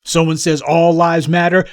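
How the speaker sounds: ordinary delivery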